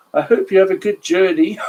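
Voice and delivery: high, laughing speech; giggling. A narrator's reading. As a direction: try to speak through giggles. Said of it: "He giggled".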